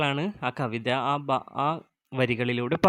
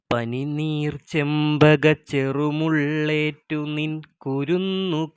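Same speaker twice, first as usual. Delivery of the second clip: slow, even recital of the verse; poem recitation; 3 semitones higher, much slower than usual. Acted. Teacher reciting Asan's poem to her students, measured recital. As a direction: read each line as a measured poem.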